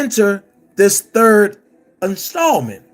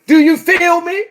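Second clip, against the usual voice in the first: lightly imitated, slightly higher-pitched catchphrase; a hint of someone else's voice, pitched up; Levi voice; 4 semitones higher than usual. Challenging Don Russell and Chicago in a light Levi impression. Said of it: "In my Levi voice".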